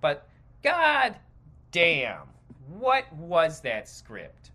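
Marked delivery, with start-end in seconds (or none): none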